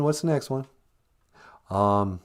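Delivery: ordinary talk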